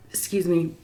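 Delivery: soft whispered apology. Real, not acted. To viewers mid-bite, whispered excuse me. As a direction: breathe it, barely voiced. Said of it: "whispered aside while eating".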